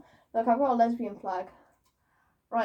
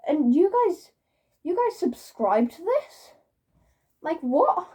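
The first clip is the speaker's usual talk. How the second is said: higher-pitched, baffled questioning; incredulous; 5 semitones higher, 4 dB louder than usual. Real, not acted. Vlogger baffled that viewers subscribe, incredulous. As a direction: lift it up in disbelief.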